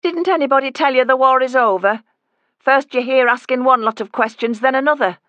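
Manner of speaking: loud, high Yorkshire scorn; mock her and send her off; mother mocks the nosy visitor, loud and high; scornful scolding. Woman scolds the snooper.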